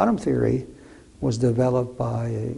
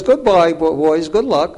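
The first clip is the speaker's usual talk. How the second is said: imitating Einstein, higher and louder; cheery loud farewell; 5 semitones higher, 11 dB louder than usual. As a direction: call out the goodbye brightly.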